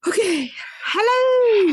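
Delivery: high sing-song greeting to cohost; sing-song hello. Real, not acted. Mic check greeting.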